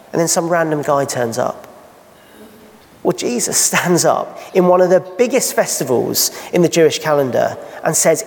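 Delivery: ordinary talk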